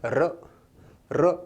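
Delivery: र said as a trilled, rolled r, twice; र with the tongue tip vibrating; rolled r sound. Shown as a demonstration. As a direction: roll the tongue on र to demonstrate. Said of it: "आप इसका उच्चारण करके देखो".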